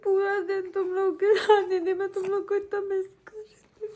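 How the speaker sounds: tearful, voice breaking; crying. Real, not acted. Wife crying as she tells her husband she missed him. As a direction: cry while telling how much you missed them. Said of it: "चल, मत रो।".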